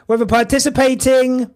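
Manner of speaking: ordinary talk, nothing marked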